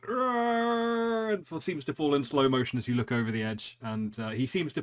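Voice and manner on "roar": high, lightly voiced falling roar; villain roaring as he drops off the building; mock falling roar. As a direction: let out a hammed-up roar as he falls. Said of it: "he falls and goes".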